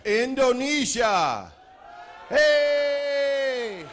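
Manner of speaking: loud, high, stretched announcement into a whoop; crowd cheer. Real, not acted. Host hailing guests from Indonesia, loud cheering. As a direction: belt out the country, then cheer.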